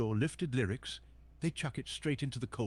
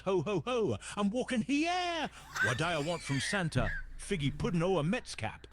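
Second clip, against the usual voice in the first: raised-pitch thick Bronx cadence; thick New York accent; 7 semitones higher than usual. Shown as a demonstration. Santa showing a streamer his New York, thick high-pitched Bronx accent. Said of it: "a really thick New York accent".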